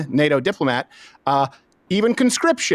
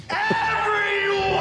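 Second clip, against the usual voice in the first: mock shout; much slower than usual. Mock call out to Europe.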